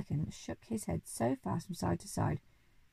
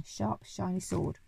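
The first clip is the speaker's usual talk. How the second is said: plain reading voice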